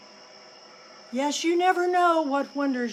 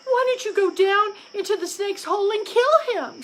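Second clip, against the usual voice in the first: high, loud demanding voice; nagging wife crow; 8 semitones higher, 4 dB louder, faster than usual. Acted. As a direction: push the husband to act. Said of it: "said Missus Crow".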